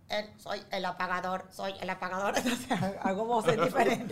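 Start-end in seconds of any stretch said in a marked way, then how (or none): 0.1-2.4 voz de robot, timbre distinto y suave. Acted. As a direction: hablar como un robot que se presenta. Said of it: "entonces vengo como un robot". (voz de robot apagador)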